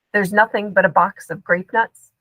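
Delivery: plain reading voice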